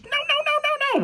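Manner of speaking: very high, whiny pleading mock; mock her frantic crying plea; mocking Ellie's panic. Critic mocking Ellie's final scene, high whining panic. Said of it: "right after goes".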